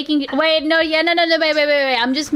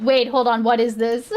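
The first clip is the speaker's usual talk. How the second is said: ordinary talk, nothing marked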